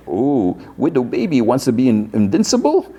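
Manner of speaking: high, rising teasing coo; mocking taunt. Chucky taunts Mayhem amid the laughter, mocking.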